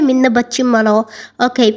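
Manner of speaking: usual speaking voice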